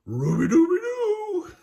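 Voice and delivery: very high, loud dog howl; Scooby catchphrase. Acted. Scooby celebrating at the end, loud high howl. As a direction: belt it out as a cartoon dog. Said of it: "what could Scooby say except".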